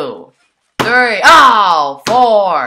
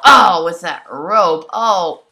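ordinary delivery

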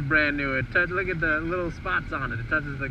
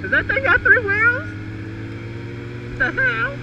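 much higher, louder disbelief; shocked, can't believe it; surprised; 5 dB louder than usual. Real, not acted. Shocked at three wheels.

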